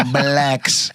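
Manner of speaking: blacks with the L held up; Trump's blacks. Shown as a demonstration. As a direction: say blacks lingering on the L. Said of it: "he keeps that L up there".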